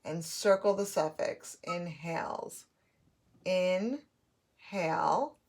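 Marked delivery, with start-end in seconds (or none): 3.5-4.0 INHALE as in, short pause, hale; syllable by syllable. Shown as a demonstration. Teacher scooping syllables, INHALE broken in two. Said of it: "my scooping matches my syllable boxes".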